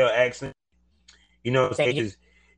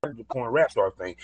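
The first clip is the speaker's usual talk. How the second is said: ordinary delivery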